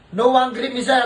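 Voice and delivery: loud, high pleading apology. Real, not acted. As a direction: plead loudly with the client. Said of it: "Ano ba dapat sabihin ko pag galit siya?".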